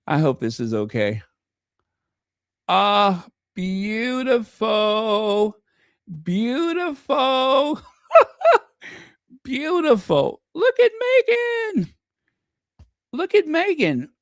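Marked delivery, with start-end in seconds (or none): 2.7-10.4 swooning beautiful. Real, not acted. Swooning over royal photos. 10.5-14.1 excited squeal. Real, not acted. Excited at photo of Meghan.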